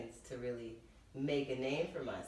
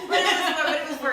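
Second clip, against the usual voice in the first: laughing; 13 semitones higher, 17 dB louder, faster than usual. Real, not acted. Laughing at her own joke.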